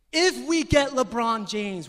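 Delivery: LeBron James hit loud and high, drawn out; stress on LeBron. Shown as a demonstration. Preacher showing the congregation where emphasis falls, emphatic stress on LeBron. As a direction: punch "If we get LeBron" hard, slowly. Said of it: "Where's the emphasis? LeBron".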